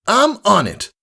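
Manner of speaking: high, brash and friendly; cocky praise from a hero; bold tomboy mare voice. Acted. Rainbow Dash praising Scootaloo after the fight, brash and friendly.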